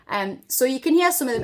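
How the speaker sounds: normal talking voice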